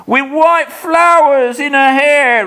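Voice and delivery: high, frightened ghost-seer voice; a sick man babbling in terror; terrified raving. Acted. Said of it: "he says".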